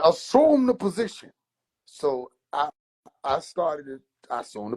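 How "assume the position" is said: higher mock-stern command; a hint of the theatrical uncle ordering; uncle's command. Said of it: "So he said".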